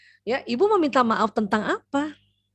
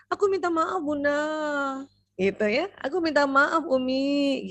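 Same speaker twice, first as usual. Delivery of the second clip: put-on child apologizing; 4 semitones higher than usual. Shown as a demonstration. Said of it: "dia dengan cepat".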